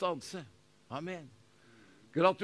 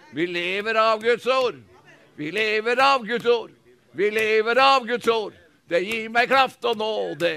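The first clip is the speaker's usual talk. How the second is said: loud, rising preacher's proclamation; full-force preaching; 4 semitones higher, 8 dB louder than usual. Acted. Pastor proclaiming to the congregation, loud preaching. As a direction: preach it out to the whole hall.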